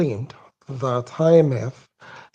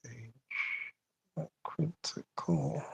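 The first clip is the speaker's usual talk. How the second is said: barely audible whispered reading; muttering to self; 18 dB quieter, slower than usual. Real, not acted. Debater hunting for the I M F page, muttering to self. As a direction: whisper it to yourself while you search.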